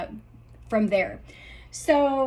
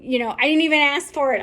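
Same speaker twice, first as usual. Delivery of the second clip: ordinary talk, nothing marked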